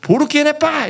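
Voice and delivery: high cry to signal presence; mock calling out. Man in toilet letting others know he's there, high mock call. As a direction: sing out the foreign phrase high. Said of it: "이렇게, 이렇게 소리를 냈어요".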